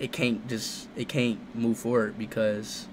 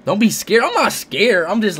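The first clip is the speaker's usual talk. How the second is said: excited shouting; 8 semitones higher, 7 dB louder than usual. Real, not acted. Hyped up before a call.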